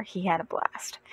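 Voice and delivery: softly whispered; hushed remark about the nephew's fun; whispered aside. Real, not acted. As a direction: breathe it out fondly.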